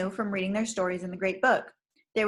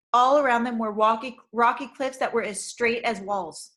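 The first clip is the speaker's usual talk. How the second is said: ordinary delivery, nothing marked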